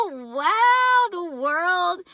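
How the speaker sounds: high, loud, laughing wow; thrilled gasp at the card; amazed laughing wow. Real, not acted. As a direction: burst out in delighted amazement.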